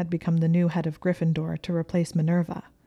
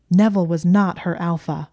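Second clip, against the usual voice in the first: loud, emphatic protest; raised voice, short of a full scream; abrupt inner scream; 7 dB louder than usual. A narrator's reading. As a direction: burst out with a forceful denial. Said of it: "part of her brain had abruptly screamed it".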